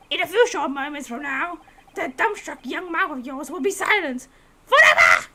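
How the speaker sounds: building to a shouted threat; threaten, then roar the last word; menacing prosecutor. Acted.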